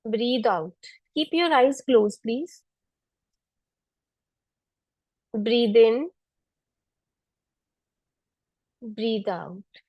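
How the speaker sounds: calm, unhurried meditation voice; guided meditation. Acted. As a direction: guide them gently through each breath. Leads seated pranayama. (ध्यान कराने वाली शांत आवाज़)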